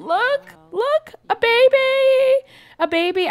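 high, slow, excited squeal. Real, not acted. Gamer squealing at a new baby, high and slow.

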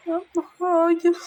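high, loud "no!"; shouted protest. Real, not acted. Gamer yelling at the enemy not to do it, loud and high. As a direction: yell it as the hit lands.

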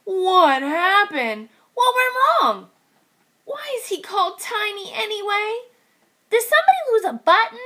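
high bewildered questions; puzzled kids after the crash; high confused piglet voices. Acted. Confused band members.

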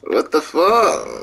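normal talking voice

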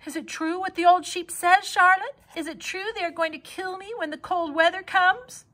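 high, loud panicked pleading; frightened pleading. Acted. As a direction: plead with her in terror. Frightened pig pleading with spider.

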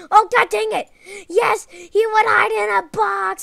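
loud, high exasperated outburst; frustrated yell. Real, not acted. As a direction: blow up at the game.

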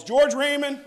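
high, loud call of a mother's name; a mother summoning her child sharply; mom calling. Retold or imitated. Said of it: "it was always".

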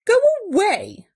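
loud, high-pitched order to leave; gruff dismissal. A narrator's reading. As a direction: shoo the intruder off forcefully. Bear sends intruding mouse away.

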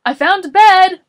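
high, very loud excited yell; Morris. A narrator's reading. Morris announcing a bed to Boris, yelling. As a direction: yell the discovery at full voice. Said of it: "Morris yelled".

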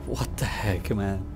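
usual speaking voice

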